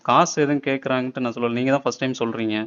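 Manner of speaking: ordinary talk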